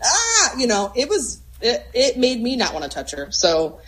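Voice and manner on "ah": high mock wail of distress; mock distressed cry. Imitating the dog's pained bark, mock distress. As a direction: imitate a dog crying out in distress. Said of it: "what level of distress are you in".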